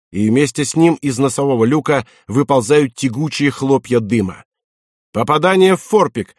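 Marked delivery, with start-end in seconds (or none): 5.1-6.3 high, raised damage report; acted midshipman's battle report; urgent report. A narrator's reading. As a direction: call the hit up to the commander. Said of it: "докладывает мичман".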